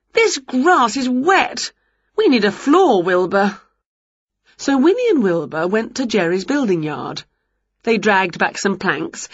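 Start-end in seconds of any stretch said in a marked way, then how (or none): 0.1-3.6 high, drawn-out moan of complaint; wailing complaint. A narrator's reading. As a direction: wail it at the cat, slowing on the first words. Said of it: "wailed Winnie".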